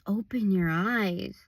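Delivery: ordinary delivery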